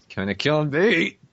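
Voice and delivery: ordinary talk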